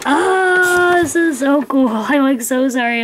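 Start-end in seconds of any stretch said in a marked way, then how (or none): 0.1-1.9 high squealed oh, easing down; thrilled squeal of delight; all excited. Real, not acted. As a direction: gush with real excitement. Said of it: "I'm, like, just all excited".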